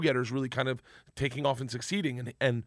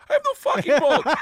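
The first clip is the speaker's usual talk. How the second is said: high, loud exasperated outburst; angry rant; 9 dB louder than usual. Retold or imitated. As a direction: yell it in disbelief at the missing boat. Igor ranting about missing boat.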